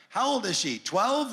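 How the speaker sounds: incredulous jab at a friend; sarcastic to buddy about his bride; mock disbelief. Joking about the young bride.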